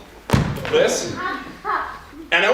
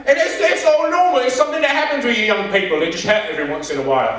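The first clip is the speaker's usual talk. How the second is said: imagined British doctor. Acted. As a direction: reassure like a cartoon British doctor. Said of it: "then a British dude walks up".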